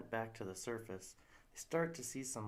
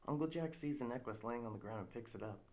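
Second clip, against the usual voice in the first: normal talking voice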